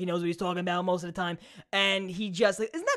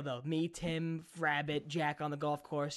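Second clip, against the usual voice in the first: usual speaking voice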